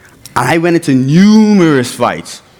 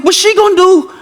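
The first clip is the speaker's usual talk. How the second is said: much higher, anxious; panicked question. Retold or imitated. Son worried as mom comes out, high anxious. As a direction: ask it in a high worried rush.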